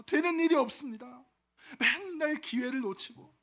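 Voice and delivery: acted lament. Young man to an old stranger, lamenting his lot. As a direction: pour out your woes, hammed up a little. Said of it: "막 자신의 신태를 막 한탄한 거예요".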